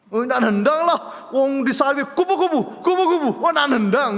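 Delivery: high, loud mock road-rage complaint; frustrated outburst. Husband telling pastor the new curse falls short, frustrated outburst. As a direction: shout the substitute curse in comic exasperation.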